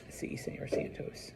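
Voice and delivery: drowsy, whispered student reply; sleepy whisper. Acted. Sleepy student answers the teacher after late homework, drowsy whisper. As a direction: murmur it voicelessly, as if half asleep.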